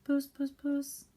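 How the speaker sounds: high, slow coaxing call for a cat; the saucepan man coaxing puss, drawn out high. A narrator's reading. Saucepan man peering about for cats, calling puss high and slow.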